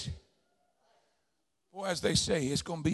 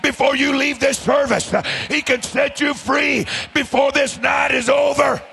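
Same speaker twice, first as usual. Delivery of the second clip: loud, driving revival preacher's delivery; full-force preaching; 5 semitones higher, 9 dB louder than usual. Real, not acted. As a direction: preach it out hard and high to the congregation.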